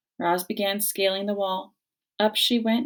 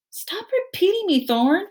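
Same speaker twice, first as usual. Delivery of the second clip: much higher, exasperated snap; annoyed sister; 12 semitones higher, 4 dB louder than usual. Acted. Sister bear scolding Thorn for copying her. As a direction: scold the little brother.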